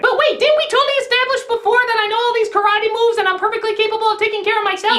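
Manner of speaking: high-pitched put-on woman's voice; indignant heroine. Lucy objects to her captor, in high indignant mock voice.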